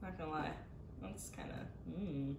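ordinary talk

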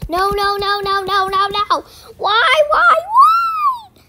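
rising shouted no's breaking into a laughing cry; high, loud, hammed-up wail; tantrum wail. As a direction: throw a mock tantrum over losing. Tantrum over the wheel.

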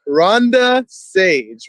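ordinary delivery

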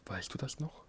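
breathy whisper of the spirits. A narrator's reading. Ghostly heads whisper to the dying emperor. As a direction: hush it as if from the curtains. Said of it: "flüsterte der eine nach dem anderen".

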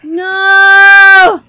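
loud, drawn-out no; real anguished shout. Real, not acted. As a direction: yell it at the screen in despair. Screaming at the scene.

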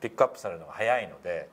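usual speaking voice

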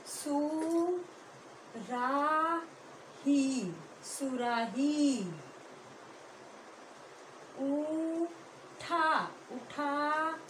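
slow dictation. Shown as a demonstration. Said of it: "dictation drawn out".

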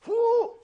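quick high whoop of attack; high whooping yell. Acted. Preacher voicing David's thrust, a whooping yell.

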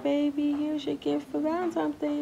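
gentle lovey hand-over; mild high sweet voice; lightly voiced sweet gift. Voicing Sam giving gift.

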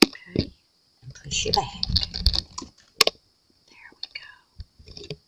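slow, drawn-out whisper of "There we go". Real, not acted. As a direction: breathe "There we go" slowly, almost silent. Quietly settling the camera.

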